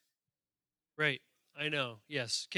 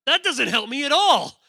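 higher, louder mock complaint; exasperated retort; 7 semitones higher, 9 dB louder than usual. To the congregation, a loud mock gripe about the pronunciation. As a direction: throw up your hands in mock frustration. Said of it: "I'm like".

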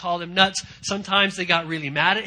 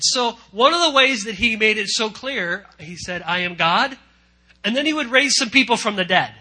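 louder building preaching; 5 semitones higher, 7 dB louder than usual. Real, not acted.

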